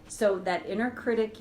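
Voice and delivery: ordinary talk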